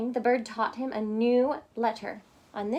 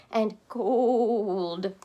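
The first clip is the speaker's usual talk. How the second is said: ordinary delivery, nothing marked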